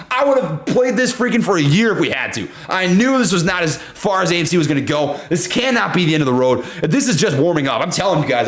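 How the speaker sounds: ordinary talk, nothing marked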